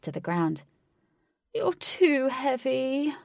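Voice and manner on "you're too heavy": high, slow, squashed creature voice; crushed under a heavy rider; high slow strained cry. A narrator's reading. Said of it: "cried Blue".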